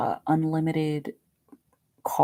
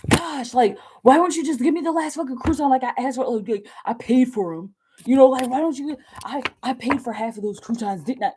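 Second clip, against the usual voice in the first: mock whiny rant; 7 semitones higher, 3 dB louder than usual. Acted crouton quarrel.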